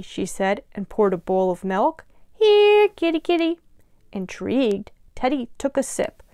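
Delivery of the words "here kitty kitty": high, singsong pet call; calling a kitty. A narrator's reading. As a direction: coax the cat over in a high voice. Girl calls the tiger like a cat.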